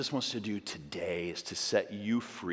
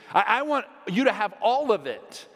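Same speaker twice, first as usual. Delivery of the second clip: higher and louder, building into fervent prayer; impassioned plea; 10 semitones higher, 7 dB louder than usual. Pretend. Peter begging Jesus to wash all of him, fervent. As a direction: pour it out, begging to be washed clean. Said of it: "Peter replied".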